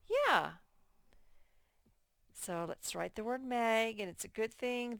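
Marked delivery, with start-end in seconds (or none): 0.1-0.5 excited yeah. Real, not acted. Finds the right word.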